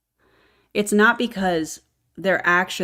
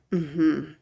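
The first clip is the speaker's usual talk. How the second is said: normal talking voice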